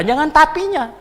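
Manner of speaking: loud, high cutting-off; sharp rebuke. Retold or imitated. Preacher cutting off a doubting critic, loud rebuke. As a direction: shut down their objection hard. Retort in a retold debate.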